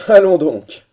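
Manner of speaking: loud, high cry that falls away; exclaiming. A narrator's reading. Corcoran answering Holkar's refusal to flee, loud exclamation. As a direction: cry it out, sweeping down from a high start. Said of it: "s'écria Corcoran".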